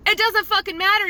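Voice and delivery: high, loud exasperated yell; furious outburst at the client; angry shout. Retold or imitated. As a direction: shout it at her, fed up.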